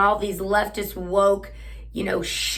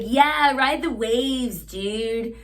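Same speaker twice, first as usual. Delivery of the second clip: put-on surfer boy voice; 3 semitones higher, much slower than usual. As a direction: drawl it slowly like a beach surfer. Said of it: "that surfer boy voice".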